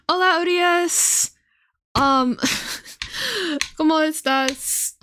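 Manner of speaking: slow, careful schoolbook Spanish; lightly voiced learner's Spanish; halting Spanish. Recalling a Spanish phrase to dad, halting learner Spanish. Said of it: "I forgot the word for lips".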